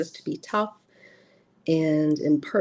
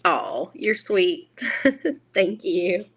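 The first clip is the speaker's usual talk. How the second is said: ordinary talk, nothing marked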